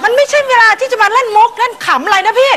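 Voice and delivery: high indignant shout. Acted. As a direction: yell back at big brother. Siblings' shouting quarrel. (ตะโกนเสียงสูงอย่างขุ่นเคือง)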